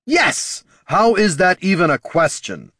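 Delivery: loud incredulous yell; exasperated shout. A narrator's reading. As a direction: throw it back at him. Jin's exasperated outburst.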